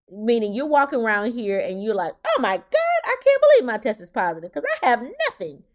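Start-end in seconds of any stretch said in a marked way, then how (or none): 2.2-5.6 high, laughing mock disbelief; amused mock surprise; mock surprised. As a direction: squeal it like someone shocked at a positive test. Voicing surprised positive tester.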